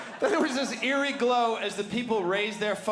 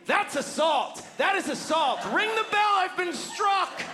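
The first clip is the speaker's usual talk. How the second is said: screaming outrage; 12 semitones higher than usual. Retold or imitated. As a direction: shriek it to the whole store as if wronged. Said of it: "and I scream".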